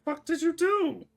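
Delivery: loud, high, drawn-out disbelief; incredulous outburst. Real, not acted. Game master to player with broken sheet, incredulous.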